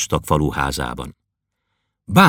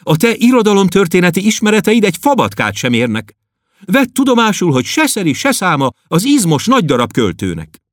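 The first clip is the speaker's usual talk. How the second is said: high, louder stern lecturing; stern girl; 12 semitones higher, 3 dB louder than usual. Acted. Klopédia sternly correcting the poet, high girlish voice. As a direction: scold him like a schoolteacher. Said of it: "mondta szigorúan".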